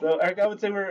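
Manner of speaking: loud laugh spilling into the words. Real, not acted. Host laughing as guest joins.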